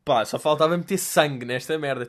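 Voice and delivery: ordinary delivery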